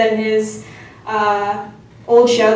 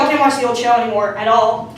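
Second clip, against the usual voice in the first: normal talking voice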